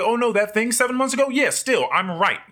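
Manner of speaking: high, mocking know-it-all; mock stubborn voice. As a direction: imitate someone clinging to being right.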